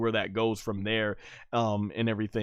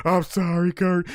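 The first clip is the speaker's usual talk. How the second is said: mock tearful apology, pitched up and louder; Stone Cold; 6 semitones higher, 5 dB louder than usual. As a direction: imitate a crying wrestler saying sorry. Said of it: "when Stone Cold was crying".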